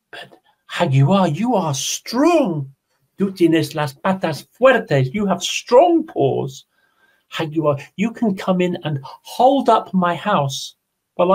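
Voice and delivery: butter him up sweetly; flattering rabbit. Acted. Rabbit coaxing the jaguar into the cave, high and flattering.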